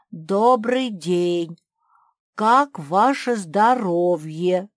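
slow, loud, drawn-out polite greeting; Max the bear. A narrator's reading. Trapped bear Max answers politely. (медленно, громко, учтиво)

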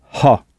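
ha with a breathed h; aspirated h. Shown as a demonstration. Teacher shows the English aspirated h. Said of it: "avec un H aspiré".

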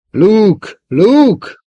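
put-on high, loud call for a missing boy; shouting. A narrator's reading. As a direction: holler the name through the house. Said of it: "kiáltotta újból". (hangosan szólongatva)

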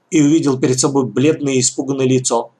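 usual speaking voice

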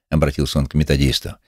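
ordinary delivery, nothing marked

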